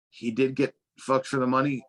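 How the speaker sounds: ordinary delivery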